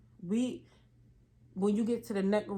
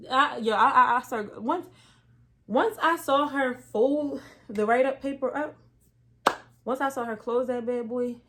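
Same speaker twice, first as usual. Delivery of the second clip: louder, higher telling; worked up telling; 4 semitones higher, 6 dB louder than usual. Real, not acted. Worked-up story of the boss closing the write-up, louder and higher. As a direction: she gets worked up as she tells it. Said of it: "it was over at that point".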